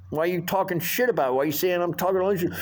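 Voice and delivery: fast, heated accusation; confrontational. Retold or imitated. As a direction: go at the cook hard. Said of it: "and I'm like".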